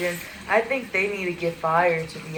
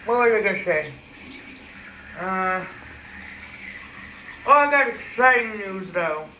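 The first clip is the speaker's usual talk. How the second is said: talking through toothpaste foam; while brushing teeth. Real, not acted. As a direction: speak with a toothbrush in mouth. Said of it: "I'm gonna say it while I'm brushing my teeth".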